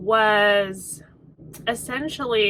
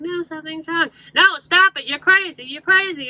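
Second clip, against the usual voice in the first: higher, louder mock pleading protest; mock frantic protest; 6 semitones higher, 4 dB louder than usual. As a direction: push back desperately, half-hammed. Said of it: "she's like".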